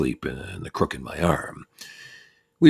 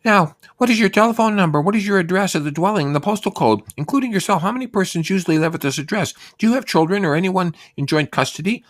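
very high, louder official lady voice; census lady voice; 6 dB louder than usual. Retold or imitated. Census woman shouting questions over the music, high voice. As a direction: rattle off the form questions high and sweet.

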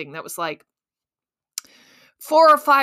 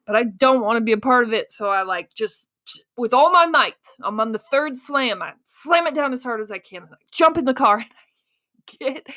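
normal talking voice

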